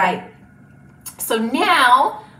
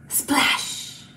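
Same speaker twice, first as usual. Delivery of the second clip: gasp, then a voiceless splash; whispered splash. Pretend. Teacher dunking a kitty in paint for children, whispered. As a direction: whisper it like a little sound effect.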